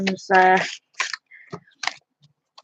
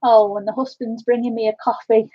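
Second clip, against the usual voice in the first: normal talking voice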